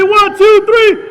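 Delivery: loud, high count to the crowd; shouted count. Acted. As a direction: belt out the final number.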